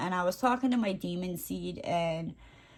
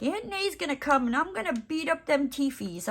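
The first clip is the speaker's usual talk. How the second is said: put-on baby talk; 7 semitones higher than usual. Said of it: "I was like".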